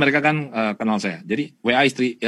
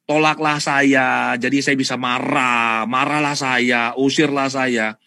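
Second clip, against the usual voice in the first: mock slow taunt; 4 dB louder, slower than usual. Voicing the negative vibration.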